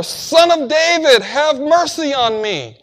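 loud, high pleading cry. Acted. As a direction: cry it out desperately for mercy. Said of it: "but he cried out all the more".

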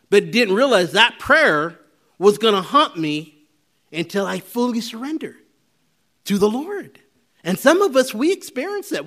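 loud, fired-up sermon delivery; preaching at full force. Acted. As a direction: preach it to the back row.

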